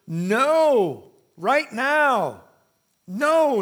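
"no" hit high and loud, again and again; emphatic NO. Shown as a demonstration. Preacher showing the Greek word is emphatic, "no" shouted high. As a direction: punch the word "no" hard. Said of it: "It's emphatic".

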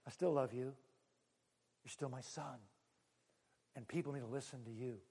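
soft, low reassurance from the Father; quietly assuring a rejected son; gentle and hushed; tender father. Retold or imitated. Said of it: "the father said".

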